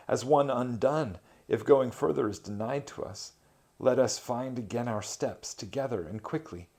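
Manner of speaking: high, pleading and afraid; frightened plea. A narrator's reading. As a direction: beg your leader not to leave you. Dante pleads, terrified, to Virgil.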